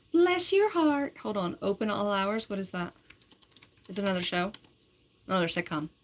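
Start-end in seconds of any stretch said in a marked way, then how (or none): 0.1-1.2 slow, high Southern "Bless your heart"; mock Southern accent, slow and high. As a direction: drawl it like a sweet Southern lady.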